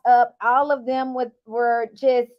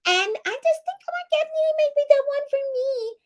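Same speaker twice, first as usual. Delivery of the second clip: high, breathy dreamy imitation; high sugary put-on voice; mocking girly voice; 13 semitones higher than usual. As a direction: imitate her swooning baby voice. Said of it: "I don't think you always talk like that".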